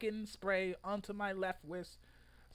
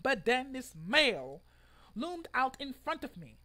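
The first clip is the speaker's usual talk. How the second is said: ordinary delivery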